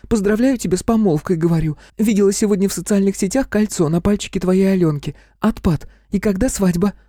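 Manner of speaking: put-on very high girlish chatter; high-pitched, pouty insistence; capricious squeak. A narrator's reading. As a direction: squeak out the gossip like a pushy little sister. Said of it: "капризно пищит Наташка".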